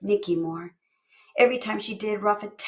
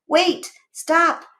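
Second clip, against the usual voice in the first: urgent high shout; yelling; 10 semitones higher, 8 dB louder than usual. Acted. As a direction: yell it sharply. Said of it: "Brianna yelled".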